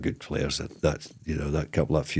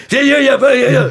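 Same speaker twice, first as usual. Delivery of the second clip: imitating a rapid Scottish rant, much higher and louder; fast Scottish gabble; 11 dB louder than usual. Mimicking a Scottish striker ranting at referees in a rapid, high Scottish accent. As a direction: rattle off the yeahs in a Scottish accent. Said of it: "so fast in that Scottish accent".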